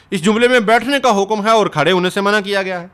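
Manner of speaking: normal talking voice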